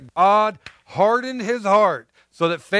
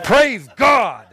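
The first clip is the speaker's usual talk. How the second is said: loud, higher-pitched praise shout; exclaimed praise; 5 semitones higher, 6 dB louder than usual. Real, not acted. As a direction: call out praise to the congregation. Preacher exclaims after joking aside.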